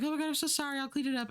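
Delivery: mock-apologetic, gushing sorry; flustered apology. [name] apologizes for dog mess.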